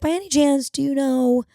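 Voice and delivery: very high, tentative question; imitated tentative asking. Nervous fan shooting her shot, imitated tentative asking. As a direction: pitch it way up as if nervously chancing it.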